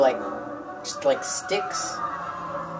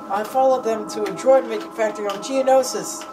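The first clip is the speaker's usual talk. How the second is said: mock Obi-Wan; 7 semitones higher than usual. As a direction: play the earnest Jedi calling home. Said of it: "he's like".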